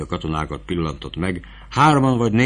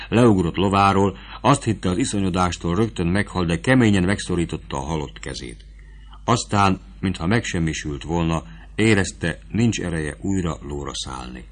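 ordinary delivery

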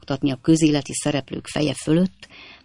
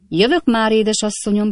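higher, raised call from afar; calling back eagerly over the crowd; 6 semitones higher than usual. A narrator's reading. Jingle calling to the hostess through the crowd, higher eager call. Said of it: "kiáltott egy hang".

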